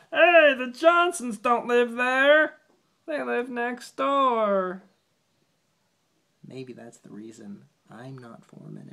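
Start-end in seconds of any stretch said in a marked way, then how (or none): 0.1-4.8 much higher, much louder, slow call across a site; yelled. Acted. A worker in the poem yells to the foreman, high and loud. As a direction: shout it out to the crew that they've got the wrong house. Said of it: "Then somebody yelled".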